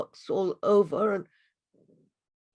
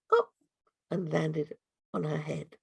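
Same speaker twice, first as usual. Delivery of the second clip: usual speaking voice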